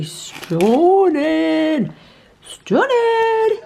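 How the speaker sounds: slow, dreamy admiration then a high squeak; awed drawl. Real, not acted. Showing off the donuts, slow and swooning.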